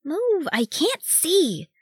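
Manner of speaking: high-pitched, drawn-out hiss of a little one; impatient high-voiced hiss; hissed complaint. A narrator's reading. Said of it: "Gimage hissed".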